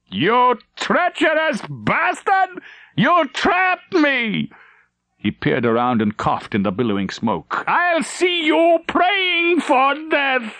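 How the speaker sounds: very high, slow, crying rage; furious shout. Acted. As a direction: spit a raging curse at the captain. Traitor raging at the captain.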